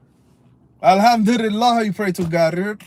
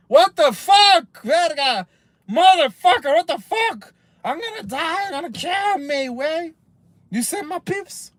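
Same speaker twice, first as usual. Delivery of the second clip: higher, hammed-up panicked outburst; mock freakout; 7 semitones higher than usual. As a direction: pretend to lose it over the two-year wait. Acting out outrage at waiting list.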